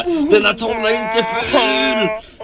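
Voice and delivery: put-on slow, high, offended old man; grandpa's voice. Narrator voicing grandpa defending his ugly painting, slow and offended. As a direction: drag out grandpa's indignant protest. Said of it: "där kommer farfar och bara".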